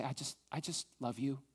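soft, quiet, intimate words of love to God; hushed devoted prayer; tender prayer. Acted. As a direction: pray it quietly like a whispered confession. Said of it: "throw up our hands and say".

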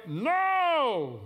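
a loud, high group shout; team yelling no. Retold or imitated. Players answering their coach, yelling. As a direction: bellow it back as the whole team. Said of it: "the team yelled back".

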